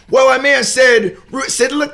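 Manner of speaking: put-on dad shouting at the gym man over his six-year-old, heated; retold shout. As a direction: yell at him, losing patience. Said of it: "now you already know how I can get".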